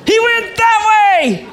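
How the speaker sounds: high, slow, excited cry pointing the way; high-pitched excited shout of direction; excited call. Acted. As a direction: call it out high like a startled witness. Preacher acts out a pointing bystander.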